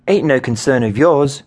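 high-pitched, irritated retort; snapping. A narrator's reading. Woman rebuffing young teammate's question, snappish. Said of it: "she snapped".

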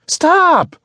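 very high, loud scream; screamed command. Acted. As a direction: scream for the driver to halt. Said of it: "Sassafras screamed again".

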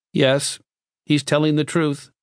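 high, monotone machine voice; intoning box. A narrator's reading. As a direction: intone it like an automaton. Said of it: "the box intoned".

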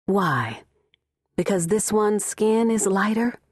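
ordinary delivery, nothing marked

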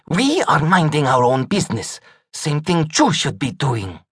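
lightly voiced tough cat; character voice. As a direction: say it like a sly cat. Kitty answers menacingly.

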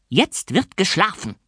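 put-on high, shrill shrieking old spinster; nasty orphanage warden screeching; shrieking matron. A narrator's reading. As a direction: screech the insults down at him. Fräulein Schimmel ordering.

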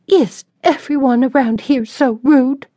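put-on high, haughty young lady; offended lady. A narrator's reading. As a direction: sniff at the town's manners. Sarah voiced, offended.

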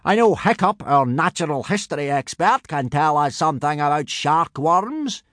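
booming Viking instructor. Acted. Gobber briefing boys on boarding boats, hearty boom. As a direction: lecture the recruits in a high, booming character voice.

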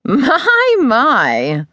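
a high, amused little chuckle through the words; chuckling. Acted. Older teacher chuckling as her student hugs her. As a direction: warm amusement breaking through. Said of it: "she said with a chuckle".

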